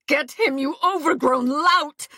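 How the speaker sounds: contemptuous high jab at the knight; insulting. A narrator's reading.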